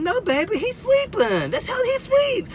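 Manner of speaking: put-on high, sweet talking to a toddler; soothing daddy. Father reassuring his little daughter, high cooing voice. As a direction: coo it to reassure a small child. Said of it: "I said".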